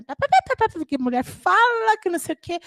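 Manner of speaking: put-on high, excited kid babbling; rapid chatter. Daughter chatters to father at the cinema, high excited babble. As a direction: rattle it off at dad.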